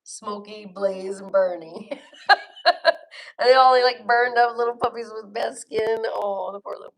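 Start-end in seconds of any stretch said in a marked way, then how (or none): 1.9-3.3 big high burst of laughter; loud delighted laugh. Real, not acted. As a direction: let out a loud giggle. Giggling at own name ideas.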